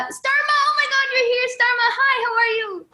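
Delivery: high, delighted hello to a fan; excited greeting. Real, not acted. Host spots a fan joining.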